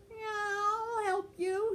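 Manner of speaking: high, slow, drawn-out cat voice; fat cat voice. A narrator's reading. Henry the fat cat offers help, high and slow. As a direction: stretch it out like a lazy cat. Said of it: "he said".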